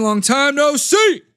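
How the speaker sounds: long time no see, shouted high and slow; loud drawn-out greeting. Shown as a demonstration. Host greets Rampage Paul joining the stream, shouted slowly. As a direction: call it out big and slow. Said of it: "greeting a friend".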